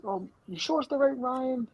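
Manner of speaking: high, mock-innocent voice; hammed-up doubtful dad on the phone; imitating the dad. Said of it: "then he goes".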